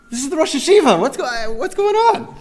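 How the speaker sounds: high, loud amazed disbelief; can you believe this, rising in disbelief; astonished outburst at the rabbi answering phones; incredulous. Retold or imitated.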